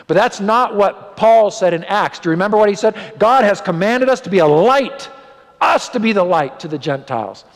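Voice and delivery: high, loud pulpit preaching; fiery preaching. Acted. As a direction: preach it out to the congregation. Preaching at full force.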